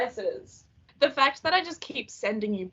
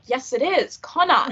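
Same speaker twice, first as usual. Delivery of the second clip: Aussie Connor insisted; 3 semitones higher, 4 dB louder than usual. Retold or imitated. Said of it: "And we're like".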